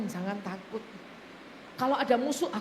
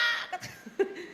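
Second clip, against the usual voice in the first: breathy laugh; 7 semitones higher than usual. Real, not acted. Laughing at own story.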